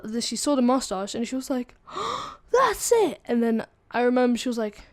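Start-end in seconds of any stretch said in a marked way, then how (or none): 1.9-2.5 high, acted gasp of horror; mock-horrified intake of breath; shocked gasp. Teacher sees the mustache, retold. 2.5-3.2 high, loud mock outburst; outraged teacher.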